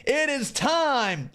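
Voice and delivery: high, slow, stretched-out build to a shout; big announcer intro. Real, not acted. Host introducing the chat roll call, drawn-out announcer call. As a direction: announce it like a main event.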